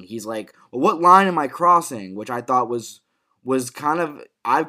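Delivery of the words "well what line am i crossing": loud, higher-pitched character quote; punchy, raised-voice demand; emphatic Alfie. Acted. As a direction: act out his emphatic challenge. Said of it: "actually emphatic Alfie. You know, he's very emphatic".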